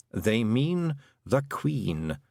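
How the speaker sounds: slow, drawn-out deadpan croak; unhurried know-it-all; dry Toad. Acted. The Toad explaining to Tiffany.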